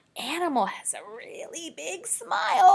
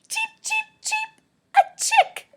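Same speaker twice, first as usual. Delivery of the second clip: high chirpy peeps, much higher and louder; chick cheeping; 9 dB louder than usual. Pretend. Teacher chirps like a chick for the class. Teacher guesses the animal aloud.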